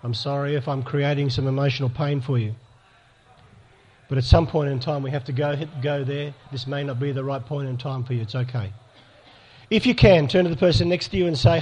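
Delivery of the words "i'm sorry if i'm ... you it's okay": soft, low comfort; a preacher softly comforting listeners who feel pain; gentle reassurance. Real, not acted. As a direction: ease them through the pain.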